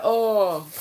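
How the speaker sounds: high "oh" breaking into laughter; imitated laughing. Retelling a friend's reaction to the lookalike, high imitated laugh. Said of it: "he was laughing".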